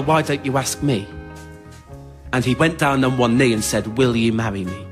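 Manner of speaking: normal talking voice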